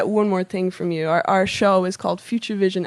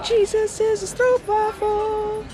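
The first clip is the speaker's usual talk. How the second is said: mock grand reading; 13 semitones higher, 4 dB louder, slower than usual. Rereading the card, higher.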